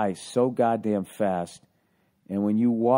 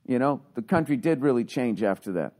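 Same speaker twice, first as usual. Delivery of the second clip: ordinary talk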